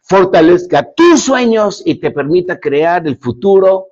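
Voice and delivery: fervent, projected blessing; closing blessing. Real, not acted. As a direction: bless the audience with building conviction. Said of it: "Permíteme bendecirte".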